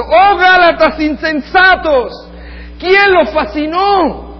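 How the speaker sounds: loud, very high, slow cry; shouting Paul. Retold or imitated. Paul crying out at the foolish Galatians, slow loud shout. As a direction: call out to them in outraged disbelief. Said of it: "Este hombre está gritando".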